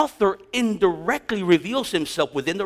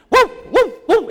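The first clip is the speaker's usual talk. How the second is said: high, loud dog woofs; short yelping woo sounds; imitated dog barking; 8 dB louder than usual. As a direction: bark like the neighborhood dogs. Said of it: "a certain symphony of barking".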